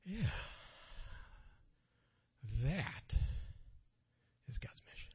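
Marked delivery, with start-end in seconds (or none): 4.5-5.1 hushed, intense whisper; preacher whispering the key line. Real, not acted. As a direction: lean in and breathe it. Dramatic whispered sermon point.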